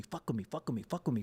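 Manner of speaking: ordinary talk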